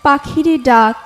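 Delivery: ordinary delivery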